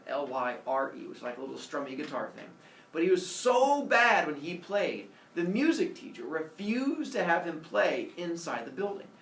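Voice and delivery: ordinary delivery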